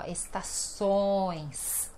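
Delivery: slow, soft "estações" with a heavily nasal ÕES; very nasal ending. Shown as a demonstration. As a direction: linger on the nasal ões of "estações", falling away. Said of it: "bem nasal, horrível".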